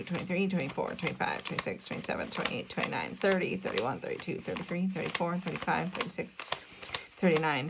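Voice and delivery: murmured counting, fading to a near whisper; counting under breath. Real, not acted. Counting cash quietly to herself, murmuring. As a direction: count the bills softly to yourself.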